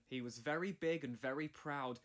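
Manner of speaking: ordinary delivery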